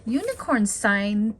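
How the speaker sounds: ordinary delivery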